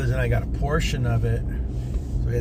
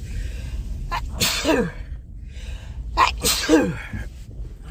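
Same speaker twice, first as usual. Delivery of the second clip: real coughing fit, high and strained; harsh coughing fit; 13 semitones higher than usual. Real, not acted. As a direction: cough hard, bent over. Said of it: "I'm gonna sneeze".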